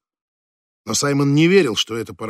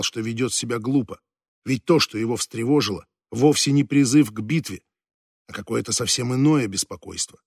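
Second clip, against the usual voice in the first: usual speaking voice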